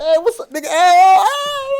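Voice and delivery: loud, very high hollering; high whooped mock greeting, laughing; rowdy greeting. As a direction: imitate a room of buddies whooping hello. Everybody else greeting noisily, contrasted with Mike.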